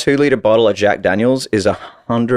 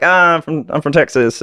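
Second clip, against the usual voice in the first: put-on American accent; 7 semitones higher than usual. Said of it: "I'll take this accent out".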